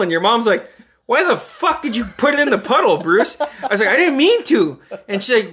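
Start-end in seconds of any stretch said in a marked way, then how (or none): 1.1-3.4 higher, exasperated mother; mock scolding mom. The friend's mom scolding the boys over the puddle, a high exasperated voice. 3.9-4.8 put-on higher, pleading little boy; whiny kid. Young Bruce excusing himself to the mom, a high whiny plea.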